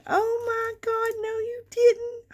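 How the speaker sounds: high, loud disbelieving squeal; squealing real surprise; shocked delight. Real, not acted. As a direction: cry out in thrilled disbelief. Opening a surprise gift.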